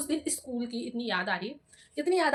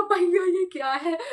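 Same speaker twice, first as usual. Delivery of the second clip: slow, higher mock wail; exasperated complaint; 4 semitones higher, 4 dB louder, much slower than usual. Student fed up with accusations, exasperated complaint. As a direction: stretch it into an exasperated plea.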